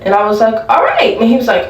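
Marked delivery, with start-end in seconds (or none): 0.7-1.2 squeaky reply. Retold or imitated. Acted reply in high squeak.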